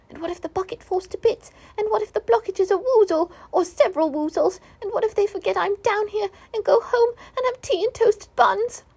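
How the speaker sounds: high, building to near tears; high squeaky panic turning tearful; rising panic. A narrator's reading.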